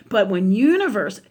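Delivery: ordinary talk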